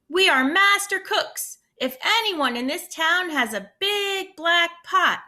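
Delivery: proud storybook shout. A narrator's reading. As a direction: call out to the whole town from the well. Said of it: "and shouted".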